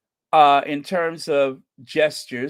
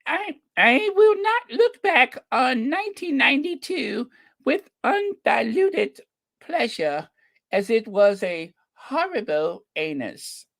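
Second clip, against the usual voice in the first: put-on Queen's English accent; 9 semitones higher than usual. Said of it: "that English accent to give it the proper pitch".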